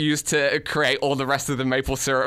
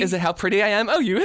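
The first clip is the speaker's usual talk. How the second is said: put-on high, giggly, self-admiring girlfriend; vain girlfriend voice; 7 semitones higher, faster than usual. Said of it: "You, you play me".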